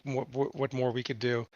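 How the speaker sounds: usual speaking voice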